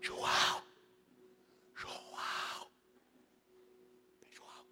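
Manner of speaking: urgent unvoiced whisper; whispered call. Peter secretly calling John at dinner, whispered. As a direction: hiss his name across the table.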